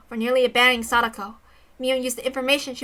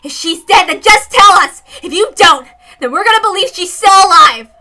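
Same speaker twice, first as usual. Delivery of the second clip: loud high demand; high loud ultimatum; insistent shout; 8 semitones higher, 13 dB louder than usual. Acted. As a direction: push her hard, shouting. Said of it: "Rena wasn't allowing her to get away".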